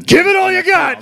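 high-pitched, hammed-up yell; movie-quote shout. Acting out a Pacino and De Niro face-off, hammed-up shout. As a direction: belt it out like an over-the-top movie line.

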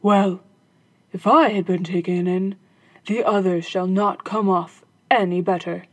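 gruff captain muttering revenge; vengeful captain. Acted. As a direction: resolve to trick the others too. Captain vows revenge to himself.

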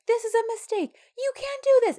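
very high-pitched imitated protest; mock screeching. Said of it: "like, screeching and just like".

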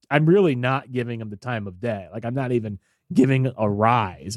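normal talking voice

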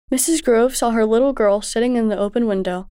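usual speaking voice

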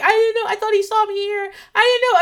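mock-whiny excuse; defensive husband. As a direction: imitate him pleading innocence. Husband's defensive reply quoted.

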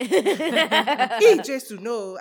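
put-on appalled dad with a Nigerian accent. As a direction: refuse like a shocked Nigerian father. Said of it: "I'm about to use an accent".

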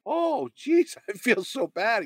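high, drawn-out mock remorse; retold remorse. Artist apologizing to the man he once insulted, remorseful. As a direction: imitate a guilty apology, stretched and high.

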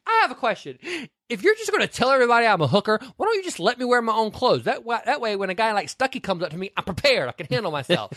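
high, fast mock Vivian voice, laughing; woman telling her date off in a high mock voice. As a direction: play the hooker heroine, squeaky and indignant. Vivian quoted in retold movie.

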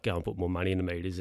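ordinary talk, nothing marked

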